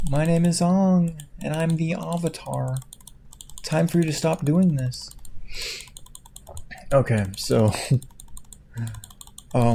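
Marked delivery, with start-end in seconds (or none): 0.1-2.8 mock movie Ong. Mocking the movie's character. 3.6-5.1 a hint of a preachy hero, higher; hammed-up movie line; lightly voiced mock hero. Mocking the movie's character.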